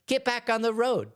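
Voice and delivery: high, sharp retold shout at the donkey; a mild hammed-up order to the donkey; mock yell. Balaam yelling at the donkey.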